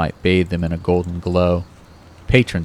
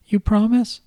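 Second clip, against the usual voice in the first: much higher girlish voice with a hint of a man underneath; a grown man faking a little girl, pitched way up; babyish high voice. Kyle faking Kate's voice on the phone to Ron, high and babyish. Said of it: "his Cuddly Kate voice holding".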